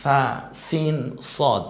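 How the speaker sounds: সা, সিন, সদ drawn out very slowly; slow letter demo. Shown as a demonstration. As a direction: model সা, সিন, সদ slowly for students. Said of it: "বলেন". (ধীরে ধীরে সা, সিন, সদ)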